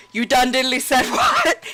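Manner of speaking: hammed-up offended old king; Greymane. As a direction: splutter it out as Greymane through a laugh. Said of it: "And Greymane's like".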